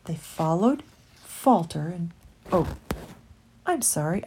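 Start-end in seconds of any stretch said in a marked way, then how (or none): none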